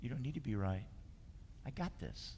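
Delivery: softly reassure, as if Spirit is comforting you; gentle voice of Spirit. Acted. Preacher voicing Spirit's comfort to the congregation, soft and tender. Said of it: "Spirit is always saying".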